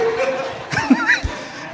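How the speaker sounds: high-pitched burst of laughter. Real, not acted. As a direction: laughs at his own story. Preacher laughs at the story. (carcajada aguda)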